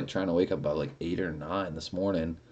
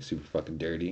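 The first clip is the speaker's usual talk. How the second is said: ordinary talk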